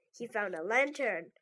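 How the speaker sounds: ordinary delivery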